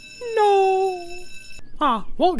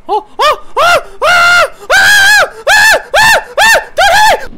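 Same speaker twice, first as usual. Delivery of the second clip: panicked screaming; 13 semitones higher, 11 dB louder, slower than usual. Acted. Screaming in fear, being beaten.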